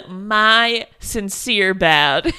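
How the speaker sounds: low-key joke apology, very drawn out; mock-sincere apology. Co-host joking as John apologizing, mock-sincere. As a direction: stretch each word with pretend gravity.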